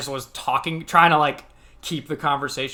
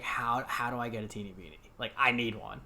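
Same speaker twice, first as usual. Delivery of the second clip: put-on childish longing; 3 semitones lower, 5 dB quieter than usual. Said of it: "watching that commercial being like".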